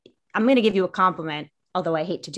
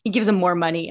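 usual speaking voice

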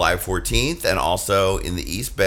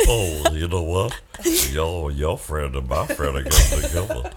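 seductive low voice; 8 semitones lower, 3 dB quieter than usual. Pretend. Seductive fantasy voice for Midge.

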